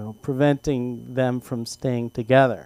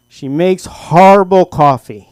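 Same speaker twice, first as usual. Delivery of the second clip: louder, slow, deadpan complaint; dad's voice; 3 semitones higher, 10 dB louder, slower than usual. Retold or imitated. Father answering the marriage counselor, deadpan louder voice. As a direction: deliver it like an on-air verdict.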